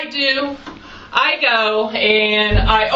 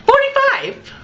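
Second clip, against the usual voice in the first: high squeal of disbelief; mock shock. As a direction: shriek the number in mock horror. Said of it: "y'all, okay?".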